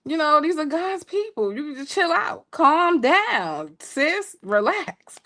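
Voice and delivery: high, giggling self-talk to calm down; flustered laughing pep talk; laughing to herself. Retold or imitated. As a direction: laugh while telling yourself to chill.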